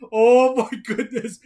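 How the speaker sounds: loud, drawn-out delighted gasp; excited gasp. Real, not acted. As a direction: gush with delight. Excited gush over the book.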